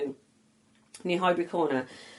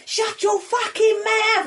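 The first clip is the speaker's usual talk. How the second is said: joking shout; 13 semitones higher, 3 dB louder, much slower than usual. Retold or imitated. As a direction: replay the joke bellow. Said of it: "like, as a joke".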